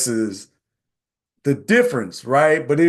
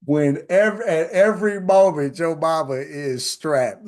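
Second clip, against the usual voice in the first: usual speaking voice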